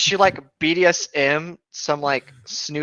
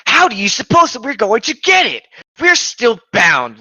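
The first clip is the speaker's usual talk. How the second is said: yelling; 9 semitones higher, 7 dB louder than usual. Acted. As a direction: bellow it at the top of his lungs. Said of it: "Link yelled at the top of his lungs".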